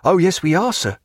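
midshipman's cry. A narrator's reading. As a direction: pipe up as a keen midshipman. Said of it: "cried the midshipman".

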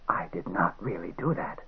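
hoarse, whispered rasp. Acted. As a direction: rasp it out under your breath. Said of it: "he said in a rasping voice".

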